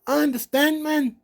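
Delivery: high Irish-accented imitation of Sheamus; Sheamus impression. Podcaster imitating Sheamus reassuring Ali, high Irish accent. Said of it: "and Sheamus is like".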